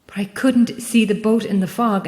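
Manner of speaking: ordinary delivery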